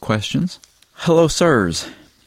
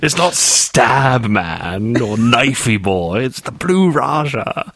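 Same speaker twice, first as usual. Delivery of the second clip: slow mock drama; 3 semitones higher, much slower than usual. Mock hero names, slow.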